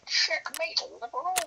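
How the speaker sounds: very high, squeaky parody voice; mock debater voice. Friend echoing the streamer's "checkmate, liberal", high mocking debater voice. Parroting a debate catchphrase in mockery.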